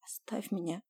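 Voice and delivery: holding back tears, voice quivering; trembling voice. A narrator's reading. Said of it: "дрожащим голосом попросила я".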